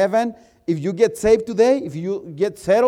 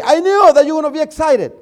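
excited; 9 semitones higher, 9 dB louder than usual. Retold or imitated. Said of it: "he said".

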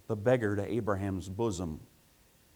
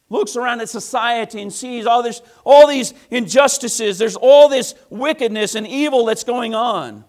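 preaching at full force; 12 semitones higher, 15 dB louder than usual. Acted. Preacher building.

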